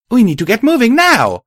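very high, loud rallying yell; urgent yell. Acted. Narrator to tired caravan ponies under attack, urgent yell. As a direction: yell the order, driving hard on the last word. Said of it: "I yelled".

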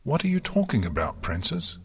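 high, hurried, alarmed question; terrified. A narrator's reading. Twilight to the failing princess, terrified. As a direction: rush it out in high-pitched fear. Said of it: "Twilight's terror was so complete".

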